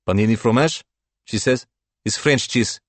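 put-on waitress in a light Slavic accent. A narrator's reading. Waitress defending the panini, light Slavic accent. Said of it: "her accent ... of the Slav".

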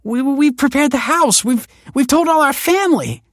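desperate words tripping over each other; stammering in panic; panicked stammer. A narrator's reading. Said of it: "stammered the woman".

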